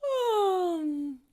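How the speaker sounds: huge high falling yawn; long vocal yawn sliding down; biggest yawn. Shown as a demonstration. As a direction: let out an exaggerated, drawn-out yawn. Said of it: "Show me your biggest yawn".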